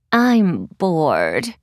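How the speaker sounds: low, lazy bored drawl; bored girl voice. Acted. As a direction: sulk it out like a bored cat-girl. Kitty's voice, bored.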